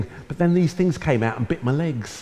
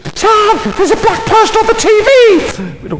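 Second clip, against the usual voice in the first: very high, loud, rapid shriek; excited scream; 8 dB louder than usual. Retold or imitated. Sister calling brother to the television, screaming. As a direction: imitate a sister yelling through the house. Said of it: "My sister would scream".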